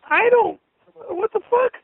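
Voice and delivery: incredulous outburst; outraged disbelief. Acted. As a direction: jump up in pitch in shocked outrage. Outraged reading the fake post.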